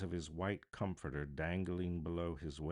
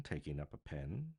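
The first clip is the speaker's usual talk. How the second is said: usual speaking voice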